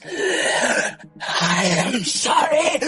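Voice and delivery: slow, high, strained monster wail; anguished creature. Acted. Game master as Laomi fleeing the whispers, anguished wail. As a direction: drag it out in a tortured creature voice.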